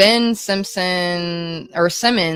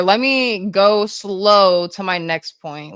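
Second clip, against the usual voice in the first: slow, stretched-out delivery; deliberately slowed down; slower than usual. Shown as a demonstration. Streamer obeying chat's request to slow down, deliberately slow. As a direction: draw it out, lingering on the words. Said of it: "I need to slow down".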